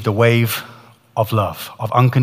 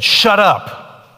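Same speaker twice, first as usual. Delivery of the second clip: sharp high snarl from the dark; hellish voice; 8 semitones higher than usual. Retold or imitated. Voice in hell silencing Ian, high harsh snap. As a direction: bark it out like a voice in hell.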